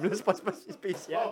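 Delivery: laughing. Real, not acted. Still laughing.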